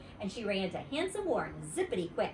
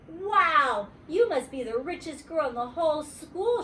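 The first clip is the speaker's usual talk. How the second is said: amazed; 7 semitones higher, 4 dB louder than usual. Acted. Warren amazed.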